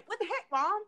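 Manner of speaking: lightly voiced Southern teen; mild Southern imitation; light Southern twang. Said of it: "it was so Southern sounding".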